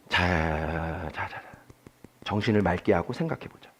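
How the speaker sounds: low, quiet talking to himself; calm self-talk. Retold or imitated. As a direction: settle yourself down quietly. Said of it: "냉철하게 생각해 봤어요".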